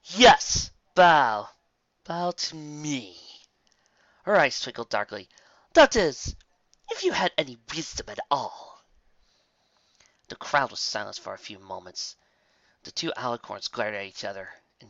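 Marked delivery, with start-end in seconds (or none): 0.0-8.8 high, slow condescending command; lightly voiced menacing queen. A narrator's reading. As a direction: lord it over the crowd. Dark queen addresses crowd.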